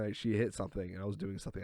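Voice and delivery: ordinary talk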